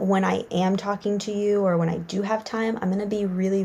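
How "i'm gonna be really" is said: ordinary talk, nothing marked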